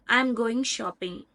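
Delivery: slow statement, pitch coming down on "shopping"; falling intonation. Shown as a demonstration. Teacher giving an example statement, falling intonation on "shopping". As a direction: let "shopping" drop to a close. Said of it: "So that is falling intonation".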